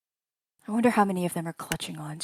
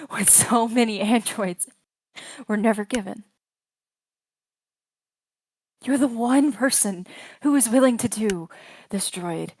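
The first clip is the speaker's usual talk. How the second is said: loud, raised, rising intensity; intense narration; 4 semitones higher, 12 dB louder than usual. A narrator's reading. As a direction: build it up loudly. GM building to an intense climax.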